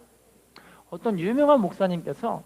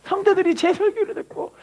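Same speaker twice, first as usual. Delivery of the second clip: higher, lightly crying plea; mock tearful prayer; 7 semitones higher than usual. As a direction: plead to God with a hint of tears. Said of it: "막 울면서 기도하면서".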